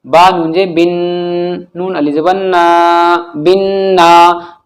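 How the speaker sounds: slow drill, long n and long vowel in "बिन ना"; spelling-out. Shown as a demonstration. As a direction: spell it, then stretch "बिन ना". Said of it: "अब हम इनकी हिज्जे करते हैं". (हिज्जे, "बिन" के नून पर गुन्ना)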